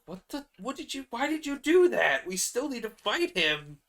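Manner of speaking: high, loud outraged disbelief; shrieking what did you do; shocked yell. Acted. Group shocked at sacrifice.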